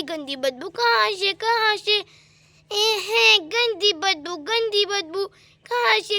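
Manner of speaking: put-on little child's lisping voice, disgusted; Guddu. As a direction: babyish fuss over a bad smell. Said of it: "Guddu की नींद खुल जाती है". (बदबू से परेशान बच्चे की तोतली आवाज़)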